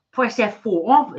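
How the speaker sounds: high, loud, slowed-down irate command; lawyer arguing with printer. Retold or imitated. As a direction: imitate an exasperated lawyer ordering a printer about. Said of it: "the lawyer, arguing with the printer".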